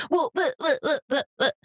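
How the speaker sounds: high, stammering mock objection; high-pitched string of interrupted buts; sputtering protest. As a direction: mimic a kid trying to argue back. Imitates child's sputtering protest.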